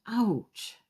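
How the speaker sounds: sharp gasp then a high yelp of pain; a pricked octopus gasps and cries out; sudden gasp, then high ouch. Acted. Spike pricks Olive's sucker.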